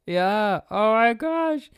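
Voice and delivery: high, loud, stretched-out gushing; acted high-pitched astonishment; amazed squeal. Acting out amazement at the glasses.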